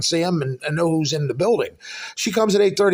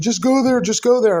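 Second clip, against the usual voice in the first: higher-pitched, insistent retold coaxing; mock urging; 8 semitones higher, 4 dB louder than usual. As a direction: imitate someone pestering, pitched up. Retelling landlord pressing him to move.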